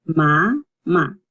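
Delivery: "mamá" slowly as ma, then a stressed MÁ; stressed last syllable, split. Shown as a demonstration. Teacher explaining the tilde, "mamá" split with emphasis at the end. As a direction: separate "mamá" and lean on the final á. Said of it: "There's emphasis on the last".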